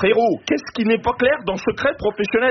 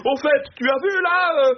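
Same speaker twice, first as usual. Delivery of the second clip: mock loud public chatter; 7 semitones higher than usual. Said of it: "des conversations publiques comme".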